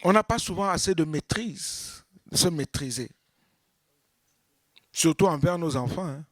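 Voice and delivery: ordinary talk, nothing marked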